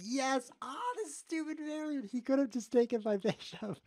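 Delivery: high-pitched crowing, breaking into laughter; high, excited cry of triumph; gleeful outburst. Real, not acted. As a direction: crow with delight at a lucky escape. Crows over opponent's missed capture.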